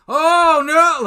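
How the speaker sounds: high, loud shocked yelp; startled shout of alarm; panicked cry. Retold or imitated. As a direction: blurt it out in horror. Retelling knocking over the star.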